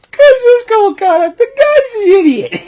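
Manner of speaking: laughing impression. Quoting with laughter.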